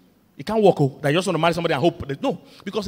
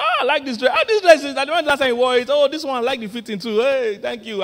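high, eager flattering husband; admiring husband; 8 semitones higher, 5 dB louder than usual. Acted. Husband complimenting his wife's dress, eager and high. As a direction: gush like a husband praising the dress.

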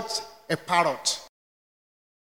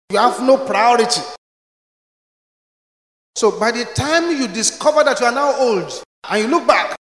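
loud, driving preacher's warning; fiery sermon warning; preaching at full force; 3 semitones higher, 4 dB louder than usual. Acted. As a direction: hammer the warning home to the congregation.

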